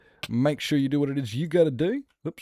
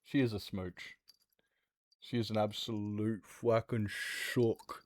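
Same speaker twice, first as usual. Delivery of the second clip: soft, quiet cooing to the cats; pet talk; 6 dB quieter, slower than usual. Real, not acted. Streamer cooing softly to her cats Teo and princess. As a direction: hush and coo like talking to a pet. Talking to the cat.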